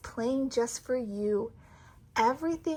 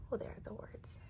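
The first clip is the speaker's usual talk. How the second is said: whisper; 6 semitones lower, 17 dB quieter, faster than usual. Real, not acted. Aside whispered while reading.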